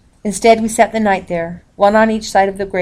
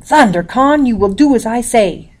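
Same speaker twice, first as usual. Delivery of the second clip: weak commanding father; 4 semitones higher, 4 dB louder than usual. A narrator's reading. Sick father commands daughter.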